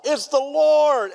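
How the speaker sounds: John yells to Peter from the boat, slow, high and loud; excited yell. Retold or imitated. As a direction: yell it out in sudden recognition. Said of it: "And he yells out".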